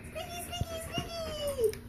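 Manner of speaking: tiny, very high squeaky chant; squeaky mouse. Pretend. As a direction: pipe it like a little mouse. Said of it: "Squeaky Mouse".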